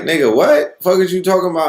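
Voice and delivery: high, loud retold outrage; mock angry street retort; indignant comeback. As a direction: fire it back at him in disbelief. Crew snapping back at Mooney.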